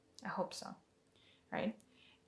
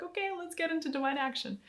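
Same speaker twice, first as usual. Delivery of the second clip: voicing the lily; 9 semitones higher, faster than usual. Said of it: "she's freaking out".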